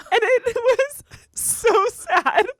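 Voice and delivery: slow, high, choked-up voice; real tears welling up, slow and high; teary-eyed. Real, not acted. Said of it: "Not me getting teary-eyed thinking about it".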